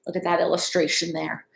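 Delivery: ordinary delivery, nothing marked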